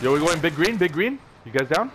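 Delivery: loud team callout; calling out. Real, not acted. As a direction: shout it to the squad. Calling teammates mid-fight.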